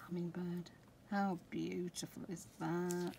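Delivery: whispered reveal. Real, not acted. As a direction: breathe the name in wonder. Naming stencil.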